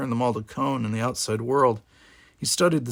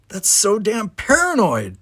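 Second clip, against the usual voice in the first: slow, louder, much higher exclamation; 10 semitones higher, 7 dB louder, slower than usual. A narrator's reading. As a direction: fling the accusation out. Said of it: "the cadet exclaimed".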